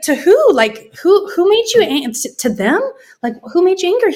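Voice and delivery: high, rising alarmed questions; anxious, baffled pleading; alarmed confusion. Retold or imitated. Wife alarmed her husband seems angry, high and anxious. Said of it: "And I was like".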